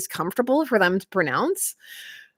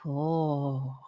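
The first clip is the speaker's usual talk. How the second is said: usual speaking voice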